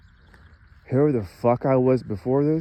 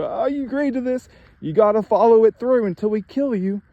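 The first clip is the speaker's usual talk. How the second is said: higher, louder, gloating threat; imitating a gloating tormentor; 10 semitones higher, 4 dB louder than usual. The laughing attackers gloat over their victim, in a higher voice. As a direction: sneer it like a laughing thug.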